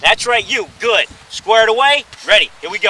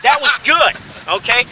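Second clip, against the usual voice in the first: normal talking voice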